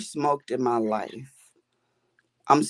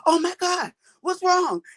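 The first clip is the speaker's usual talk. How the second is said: high-pitched imitation of alarm; startled husband; 13 semitones higher than usual. Caller acting her husband startled awake by her laughing, alarmed. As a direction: wake up in a panic, pitch jumping. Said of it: "He wakes up".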